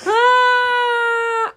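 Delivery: a high, loud honking shriek of laughter; real honking shriek of laughter; goose honk. Real, not acted. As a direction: let out a sudden honk of a laugh. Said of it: "I'm sorry for my goose honk".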